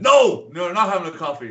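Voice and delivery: high, loud opening no, then easing; mock loud refusal. Barman refusing a coffee order, imitated loud outburst. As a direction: act out a barman's booming refusal.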